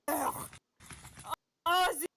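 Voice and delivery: normal talking voice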